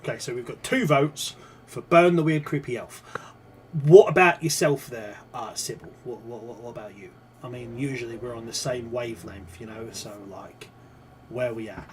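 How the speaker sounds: a Cockney accent, lightly put on, chatty. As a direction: talk to the party like a Cockney geezer. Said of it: "That is a Cockney accent".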